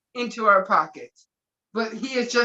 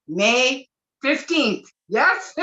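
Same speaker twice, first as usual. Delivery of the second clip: ordinary talk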